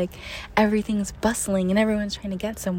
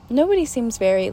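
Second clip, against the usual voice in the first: ordinary delivery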